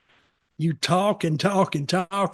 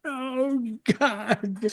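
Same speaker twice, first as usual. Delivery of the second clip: fake crying; 6 semitones higher, 6 dB quieter than usual. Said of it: "I'd act like I'm crying".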